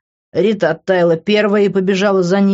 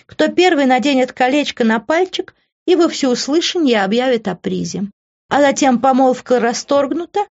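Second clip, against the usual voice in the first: high-pitched, smug taunting; mocking, self-satisfied woman; taunting schemer Ivy; 7 semitones higher than usual. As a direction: needle them sweetly in a high voice.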